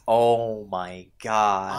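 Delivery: slow, drawn-out exasperation; exasperated. Real, not acted. Complaining to co-host about the tutorial, slow and exasperated. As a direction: drag out every word, fed up.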